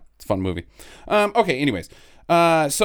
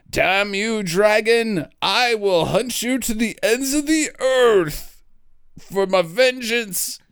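mock heroic vow; 6 semitones higher, slower than usual. Knight vowing vengeance, mock epic.

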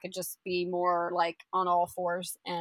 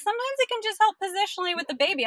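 light, higher coaxing midwife voice, quickening at the end; bright reassuring impression of the midwife; quoting the midwife; 6 semitones higher, 6 dB louder than usual. Retelling the midwife's advice, higher and coaxing. Midwife quoted giving instructions.

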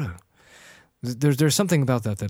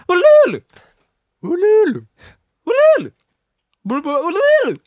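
very high, loud ululation; high ululating yell; 9 dB louder than usual. Acted. Host ends the pirate act, loud high ululating cries. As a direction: wail "ululu" in short bursts.

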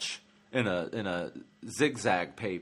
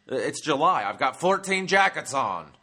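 mock panhandler; 4 semitones higher, 6 dB louder than usual. Panhandler voiced mockingly.

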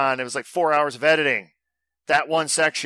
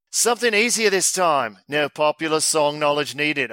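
raised, put-on Australian voice; bad Australian accent. Host reading listener's quiz email, bad Australian accent. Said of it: "Maybe I'll do my Battle Strand accent".

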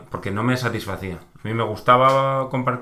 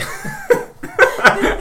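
very high delighted laugh; high squeal of laughter; 8 dB louder than usual. Real, not acted. Laughing hard at the friend's joke about the accent.

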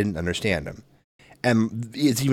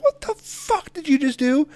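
much higher, slow outraged cry; shocked outburst; slower than usual. Retold or imitated. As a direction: shriek it in disbelief. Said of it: "And I'm like".

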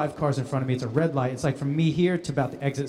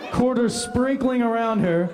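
ordinary delivery

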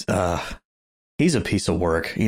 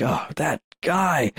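drawn-out, higher-pitched exasperation; exasperated groan; 7 semitones higher, slower than usual. Retold or imitated. Fan reacting to a hated villain, exasperated. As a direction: stretch it out in exasperated disgust.

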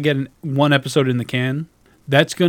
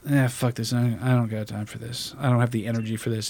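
resigned self-talk; 2 semitones lower, 6 dB quieter than usual. Retold or imitated. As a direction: let the energy drop away as you give up. Voices own giving-up thought.

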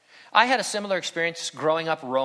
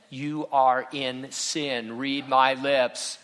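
each word slowed and spaced out; deliberate slow "You are in sin"; emphatic slow repeat; much slower than usual. Pretend. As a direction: spell it out word by word.

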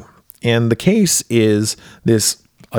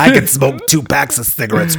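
lightly voiced heavy smoker; joking chain-smoker character voice; Mrs. Wadsworth impression. Podcaster riffs on the movie mom's smoking, mock smoker voice. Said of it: "She talks like this".

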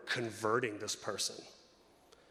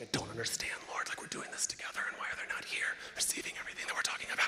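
hushed, frustrated whisper to God; fuming whisper; 3 semitones lower, 4 dB quieter, faster than usual. Retold or imitated. Pastor in church fuming at God, whispered. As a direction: whisper the complaint through fuming. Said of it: "I'm just like".

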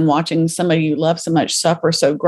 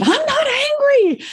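high, strained denial, much higher; insistent denial; slower than usual. Retold or imitated. Friend says she's angry, Susan protests in a high denial. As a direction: protest too much, voice jumping up.

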